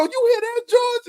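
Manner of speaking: very high comic imitation; high mock taunt. Retelling the man's taunt to friends, high mocking voice. Voicing man in retold video.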